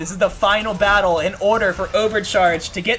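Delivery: loud, high hype casting; excited play-by-play. Real, not acted. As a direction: build the hype for the final fight. Live esports play-by-play.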